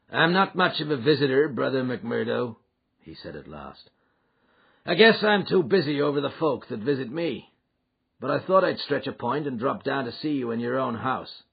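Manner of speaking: a big boss dropping in, full of himself; voiced Boss McGinty. Boss McGinty visits McMurdo.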